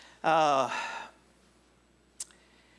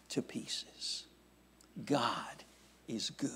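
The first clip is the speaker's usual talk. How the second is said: hushed whisper; 5 semitones lower, 10 dB quieter, slower than usual. Acted. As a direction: whisper it softly. Sermon hushed emphasis.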